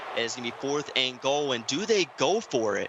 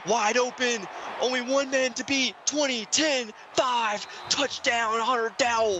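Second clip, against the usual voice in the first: excited touchdown call, much higher, held back from a full yell; hushed excitement; 12 semitones higher than usual. Real, not acted. Commentator calling a long touchdown while others sleep, hushed excitement. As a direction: call it high and thrilled without waking the house. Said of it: "whispering in excitement".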